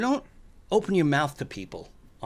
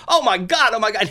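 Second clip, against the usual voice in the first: much higher, loud startled exclaiming; alarmed cries; 7 semitones higher, 6 dB louder than usual. Retold or imitated. Next moviegoer suddenly jolted, loud alarmed cries. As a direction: cry out in shock. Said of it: "he's going".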